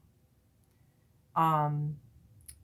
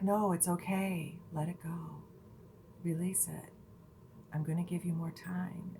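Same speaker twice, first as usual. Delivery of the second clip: soft-spoken; 6 dB quieter than usual. Retold or imitated. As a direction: ease them gently into letting go. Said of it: "in her soft-spoken voice".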